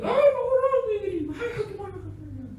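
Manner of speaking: very high, gushing, laughing; laughing delighted admirer; excited fan. Retold or imitated. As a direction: squeal a delighted greeting. Excited fan greets pastor.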